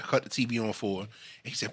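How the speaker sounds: usual speaking voice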